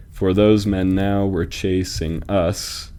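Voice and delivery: slow, measured scansion; metrical, deliberate pacing; syllable-counting reading. Shown as a demonstration. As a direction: draw out each syllable so it can be counted. Offers altered line, counting syllables.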